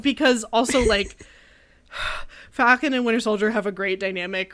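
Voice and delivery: ordinary talk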